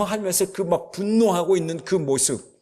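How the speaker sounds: ordinary talk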